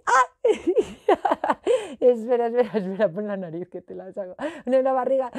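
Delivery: squealing laugh while being tickled. Pretend. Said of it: "que te hago cosquis". (riendo por las cosquillas)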